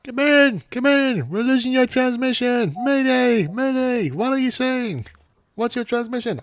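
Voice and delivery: high, loud urgent radio voice; hammed-up mayday call; mock radio call. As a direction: shout into a crackling radio.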